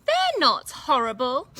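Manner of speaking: Katy sniffing back at Grandma, loud; sniffed. Acted. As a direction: huff it back, hurt and cross. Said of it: "sniffed Katy".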